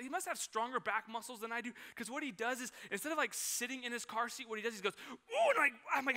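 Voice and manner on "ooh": put-on high, loud strained child's groan; straining grunt. Toddler arching his back against the car seat, high strained groan.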